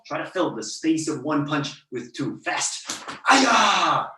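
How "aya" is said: loud, high martial arts kiai; sharp karate-class battle cry; loud yell. Shown as a demonstration. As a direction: yell it out high, falling away at the end. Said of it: "Loud yell on the second punch".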